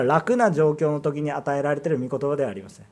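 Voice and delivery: usual speaking voice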